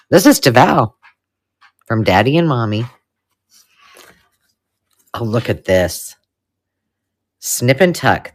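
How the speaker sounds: ordinary talk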